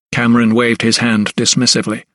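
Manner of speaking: usual speaking voice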